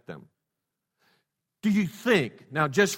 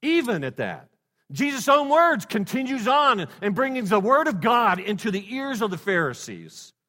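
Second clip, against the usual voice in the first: rising, louder preacher's climb; sudden fervent sermon surge; preaching swell; 9 semitones higher, 6 dB louder than usual. Acted. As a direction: lift the room with it. Preacher swells on Jesus' words.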